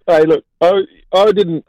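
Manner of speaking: ordinary talk, nothing marked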